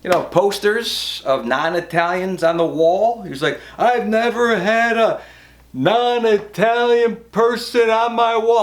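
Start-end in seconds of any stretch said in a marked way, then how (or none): 3.8-8.6 slow, high, indignant mock rant; drawn-out, hammed-up indignation; mad uncle. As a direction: stretch every word in mild outrage. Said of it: "he was always mad at me".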